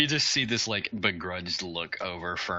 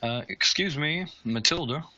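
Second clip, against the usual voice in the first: higher, timid call at the window; polite Fern; 9 semitones higher than usual. Acted. Fern calling through the window, timid and polite.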